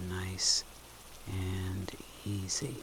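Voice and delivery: very soft and low, almost whispered; low, gentle murmur to settle the listener; hushed soothing cue. Shown as a demonstration. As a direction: let it fade to a near whisper. Soothes during the actual exhale.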